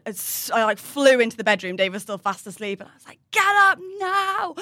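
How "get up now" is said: high, drawn-out panicked shout; slow, high-pitched urgent yell; retold urgent scream. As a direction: yell to rouse a sleeper, re-enacted. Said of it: "screaming at Dave".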